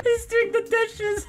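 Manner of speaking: high voice through laughter; giggling out the words; laughing. Real, not acted. Laughing at meme.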